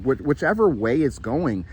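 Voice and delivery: ordinary delivery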